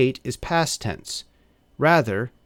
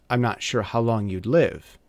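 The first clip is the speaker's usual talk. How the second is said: normal talking voice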